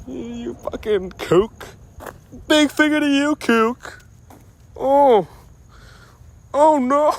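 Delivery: very high, loud put-on voice; mock insult voice. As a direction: hurl a playful insult in a squeaky-high register.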